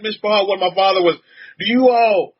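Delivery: ordinary talk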